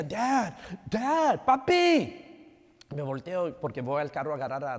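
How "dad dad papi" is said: high, louder imitation of a little girl; mock child's call, pitched way up; toddler calling out. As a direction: call for daddy like an insistent toddler. Imitates toddler calling her dad.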